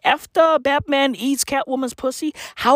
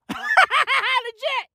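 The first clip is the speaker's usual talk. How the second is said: laughing squeal. Real, not acted. Laughing at own joke.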